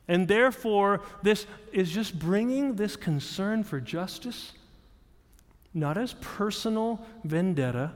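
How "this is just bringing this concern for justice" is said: ordinary talk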